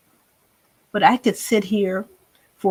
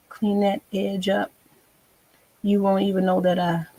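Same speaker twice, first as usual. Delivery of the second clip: ordinary delivery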